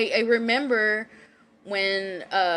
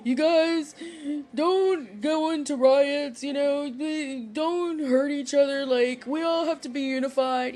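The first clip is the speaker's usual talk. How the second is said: high, pleading voice imitating a crying wrestler; imitating tearful wrestler; 7 semitones higher than usual. Wrestler on TV begging viewers, high tearful plea. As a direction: beg them not to riot, voice high and trembling. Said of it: "He was all crying. He's like".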